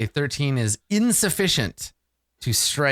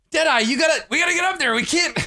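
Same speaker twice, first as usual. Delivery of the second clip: high-pitched pirate squawk; frantic pirate underlings; faster than usual. Acted. Pirates shut out of the room call their mate, high and frantic. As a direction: complain helplessly in a high pirate voice.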